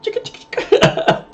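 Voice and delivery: voiceless mouthed record scratching; quick whispery scratch sound effect; DJ scratch noise. Pretend. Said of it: "Like a D J scratch".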